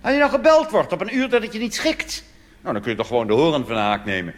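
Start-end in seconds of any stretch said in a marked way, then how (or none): none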